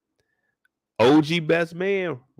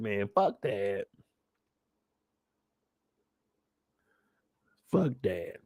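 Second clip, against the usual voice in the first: lower, softer, slow; muttered curse; 6 semitones lower, 9 dB quieter, slower than usual. Retold or imitated. Son leaving the house, low and soft. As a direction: grumble it walking away.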